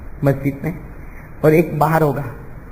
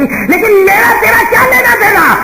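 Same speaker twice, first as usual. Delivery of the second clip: stern rebuke; 13 semitones higher, 4 dB louder than usual. Retold or imitated. God rejects the unloved.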